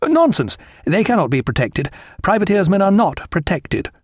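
high-pitched, indignant officer; dismissive young captain. A narrator's reading. Young captain contradicting Jack, dismissive and high.

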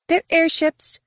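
high-voiced guide stating the obvious; cool woman guide, pitched far up; dismissive woman guide. A narrator's reading. Guide explains the craft to Mara, dismissive.

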